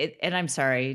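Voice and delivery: ordinary talk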